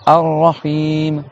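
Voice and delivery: Ar-Rahim, slow, held R and long i; prolonged i. Shown as a demonstration. Teacher modelling for students, Ar-Rahim slow with long i. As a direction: draw out the i of Ar-Rahim. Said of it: "avec le i prolongé".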